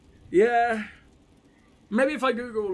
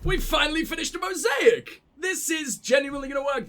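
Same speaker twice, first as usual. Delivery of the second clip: excited shout; 8 semitones higher than usual. Real, not acted. Finally finished a mosaic.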